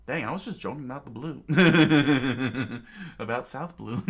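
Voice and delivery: laughing. Real, not acted. Narrator laughs at joke.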